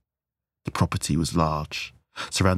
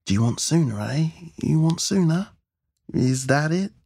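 raised pitch, building in intensity; menacing taunt; 9 semitones higher than usual. A narrator's reading. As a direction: goad the hostage, rising with each question. Gunman taunts struggling hostage.

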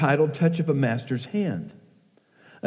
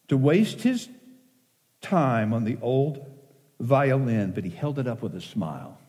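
poem recitation. Acted.

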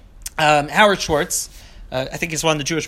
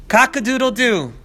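lightly crowed, a touch higher; rooster crow; 7 semitones higher than usual. Acted. Two men crowing as roosters, a mild crow. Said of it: "preening their feathers and crying".

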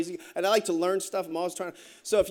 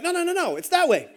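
insistent objection; 8 semitones higher than usual. Retold or imitated. Retold argument over which way back.

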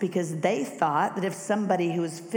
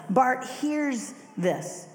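ordinary talk, nothing marked

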